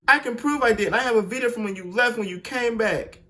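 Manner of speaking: put-on a touch of a desperate boyfriend; pleading boyfriend.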